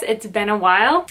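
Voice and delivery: normal talking voice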